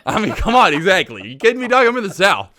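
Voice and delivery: high, loud laughing protest; excited laughing protest. Real, not acted. Laughing defending Spam.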